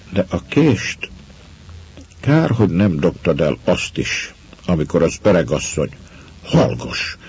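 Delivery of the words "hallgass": breathless, unvoiced gasp of fright; frightened gasp. A narrator's reading. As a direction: gasp the word out in fear. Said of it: "hördült föl rémülten".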